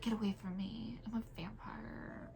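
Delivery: mock whisper, a hammed-up hiss of a weird goth girl; mock vampire whisper. Mimicking the weird school girl who hisses at everybody, in a mock whisper. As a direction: hiss it softly like a kid pretending to be a vampire. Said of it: "it's like".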